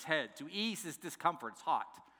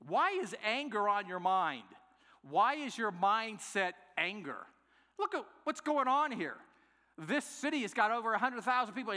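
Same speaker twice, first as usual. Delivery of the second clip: higher, emphatic questioning; incredulous rebuke; 7 semitones higher, 3 dB louder than usual. Acted. God questioning angry Jonah, in a raised, incredulous rebuke. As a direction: challenge him with rising incredulity.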